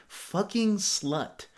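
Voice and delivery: very slow, drawn-out sneer; mocking the guy's vile text; disgusted reading. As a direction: drag the insult out.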